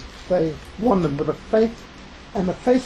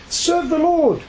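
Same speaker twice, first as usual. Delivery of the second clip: commanding cry; 8 semitones higher, 3 dB louder, slower than usual. Acted. Joshua's closing command quoted.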